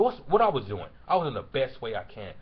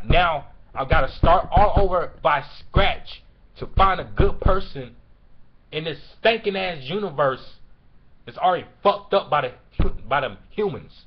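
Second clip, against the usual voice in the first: angry; 3 semitones higher, 8 dB louder than usual. Real, not acted. Raging about starting over after nine years, angry. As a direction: shout it out, hammering each word. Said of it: "So why I'm so angry?".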